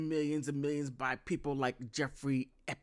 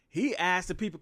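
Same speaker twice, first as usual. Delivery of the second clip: ordinary delivery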